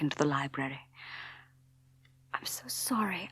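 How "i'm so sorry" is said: soft, breathy whisper of an apology; whispered, sorry and shaken. A narrator's reading. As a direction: barely breathe the words. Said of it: "I whispered".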